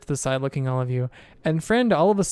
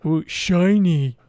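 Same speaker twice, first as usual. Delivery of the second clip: ogre voice; 3 semitones higher, 6 dB louder, much slower than usual. Acted. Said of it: "And he points at you, Guardian".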